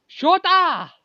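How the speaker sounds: loud, high call of Shota; shouting a name. Acted. Calling out to Shota, loud and high. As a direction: yell Shota across the room. Calls again, corrected name.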